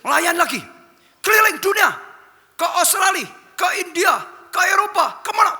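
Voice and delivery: high, loud rising command; God sending him out. Acted. God sending the old pastor around the world, loud high command. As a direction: fling out the places one after another, loudly. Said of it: "Tuhan berkata".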